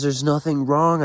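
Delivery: slow, emphatic quoted reply; slow speech. A narrator's reading. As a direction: stretch out each word in slow insistence. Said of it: "I say slowly from the floor".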